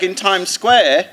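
ordinary talk

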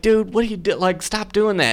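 high-pitched, urgent pleading at normal volume; retold alarmed protest. To the buddy yelling at ghosts, alarmed protest. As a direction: act out telling a buddy to quit it.

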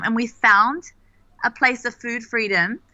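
ordinary talk

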